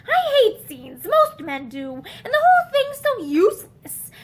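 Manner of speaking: exasperated outburst; angry outburst. A narrator's reading. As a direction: vent the frustration.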